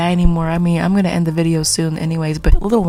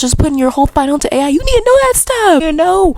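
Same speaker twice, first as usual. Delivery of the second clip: high-pitched, worked-up scolding; exasperated rant; 8 semitones higher than usual. Real, not acted. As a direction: plead urgently, voice pushed way up. Exasperated rant at cheating students.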